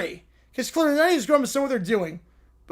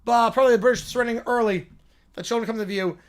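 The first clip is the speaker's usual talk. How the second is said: ordinary talk, nothing marked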